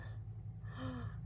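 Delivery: a soft breathy gasp of delight. Real, not acted. Showing off her ribbons, a soft delighted gasp. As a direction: gasp at something lovely.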